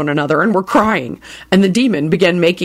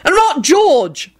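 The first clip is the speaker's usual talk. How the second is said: hollering; 9 semitones higher than usual. Acted.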